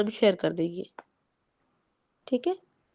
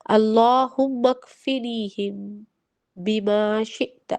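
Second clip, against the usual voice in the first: put-on each syllable drawn out slowly for children to repeat; teacher slowly reciting a dua for children to learn; slow recitation; much slower than usual. Shown as a demonstration. Very slow, careful dua recitation. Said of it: "اس کے لیے ایک چھوٹی سی دعا ہے".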